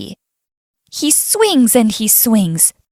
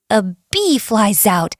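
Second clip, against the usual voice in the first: plain reading voice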